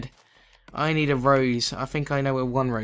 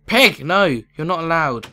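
loud, raised telling-off; scolding; 3 semitones higher, 9 dB louder than usual. Pretend. Gamer telling a pig it's not allowed in, loud scolding. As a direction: shout the pig away, then forbid it.

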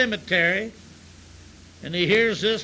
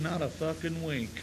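deadpan mutter; 4 semitones lower, 9 dB quieter than usual. Acted. Deadpan punchline reply.

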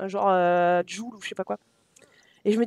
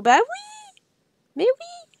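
very high, drawn-out rising squeak; high-pitched gushing agreement; mock-cute squeal; slower than usual. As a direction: coo it way up high. Gushing over character's love of nature.

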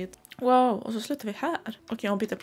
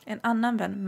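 ordinary delivery

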